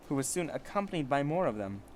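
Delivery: ordinary delivery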